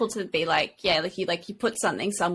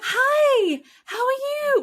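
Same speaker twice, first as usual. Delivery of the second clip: high, sugary fake-happy voice, pitched way up; fake cheerful greeting; much slower than usual. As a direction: put on a forced bright smile for the baby. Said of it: "But I'm gonna have to be like".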